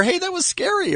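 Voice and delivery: high-pitched mock surprise; imagined surprised reaction. Interviewer imagining another reaction from the front row, mock surprised. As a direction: lift it high, like a sudden realization.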